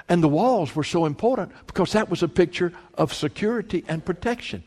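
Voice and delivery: ordinary talk, nothing marked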